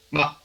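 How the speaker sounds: quick, short ma; toneless syllable. Shown as a demonstration. Teacher ending the list of tones, quick toneless ma. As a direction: say MA very briefly, no tone. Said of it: "Y sin tono".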